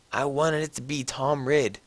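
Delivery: plain reading voice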